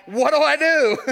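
high, loud helpless question; a hammed-up helpless dad; mock panic. Father handed his newborn, hammed-up helpless panic.

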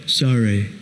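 put-on low, grudging kid's sorry; deep mock sorry. A five-year-old muttering a forced apology to his sister, deep and grudging. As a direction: drop the voice way down, a sulky boy. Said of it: "I had a very deep voice as a five-year-old".

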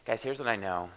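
ordinary talk

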